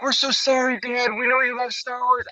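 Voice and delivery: dad imitating his girls apologising; mock sweet daughters. Daughters consoling their dad, high mock voice.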